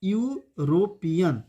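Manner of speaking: European drawn out very slowly, starting with a clear "yu"; slow demonstration. Shown as a demonstration. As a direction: stretch out European for the students. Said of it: "इसका यू".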